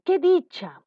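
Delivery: loud, high burst of demonstrated delight; exclaiming. Shown as a demonstration. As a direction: exclaim it as a model phrase. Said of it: "Queremos exclamar". (exclamación de dicha, fuerte)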